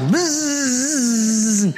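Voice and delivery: a sharp high mock buzz of angry hornets. Pastor imitating angry passengers as a buzzing swarm. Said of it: "mad as hornets".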